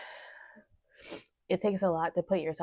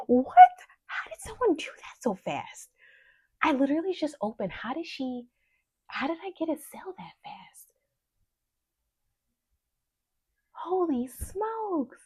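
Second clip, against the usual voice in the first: high-pitched, stunned questioning; can't believe it, voice shooting upward; amazed, high and incredulous; real disbelief; 9 semitones higher, 6 dB louder than usual. Real, not acted. Stunned by instant first sale.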